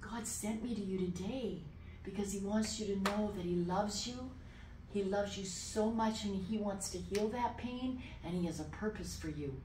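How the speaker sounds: soft, sincere comforting; quietly compassionate message; tender reassurance. Retold or imitated. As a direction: reassure him softly and earnestly. Retold reassurance to crying man.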